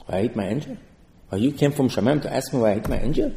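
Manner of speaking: quiet, incredulous Balaam; indignant. Acted. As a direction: hush it while asking why it's anyone's concern.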